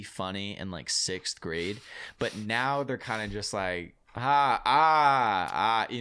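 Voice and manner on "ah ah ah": fake laugh. Mocking reaction to puns.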